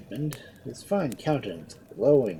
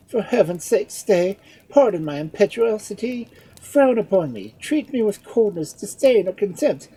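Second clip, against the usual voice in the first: loud, high, fervent entreaty; desperate pleading; 12 semitones higher, 10 dB louder than usual. A narrator's reading. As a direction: pour it out on your knees. Edmund kneeling, begging.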